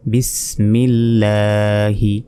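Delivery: very slow, chanted Bismillahi; slow recitation. Shown as a demonstration. Teacher recites the joined word for students, slow chant. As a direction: stretch it out like a reciter. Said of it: "spelled then recited".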